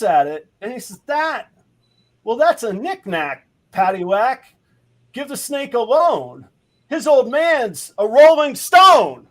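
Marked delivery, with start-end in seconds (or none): 1.1-9.3 high, sing-song proclamation building to a drawn-out finish; big punchline delivery. Acted. Bank manager explaining the elephant to Patty, proclaimed punchline. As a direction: declaim the pun higher and louder as it lands.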